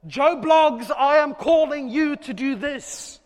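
loud, slow divine call; voice of God calling. Acted. God summoning Joe Bloggs, loud and slow. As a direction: boom it out as God summoning someone.

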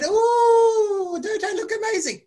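high, long swooping "ooh" of delight; imitating a thrilled kid; delighted show-off. Child admiring her drawn face.

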